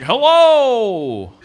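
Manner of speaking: loud and high, calling out to nobody; shouting into the void. Retold or imitated. Confused dad calling out into the void, loud and high. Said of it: "He's like".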